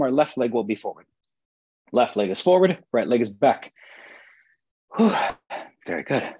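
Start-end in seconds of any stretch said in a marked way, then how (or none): 5.0-6.3 breathless, puffing after the pose; catching her breath between words. Real, not acted. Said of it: "I'm out of breath".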